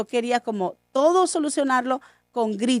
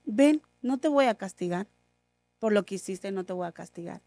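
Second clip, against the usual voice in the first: softly, calmly reassuring; a quiet, gentle father's promise; soft and serene, no anger at all; 6 semitones lower, 8 dB quieter than usual. Retold or imitated. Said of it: "con toda la serenidad".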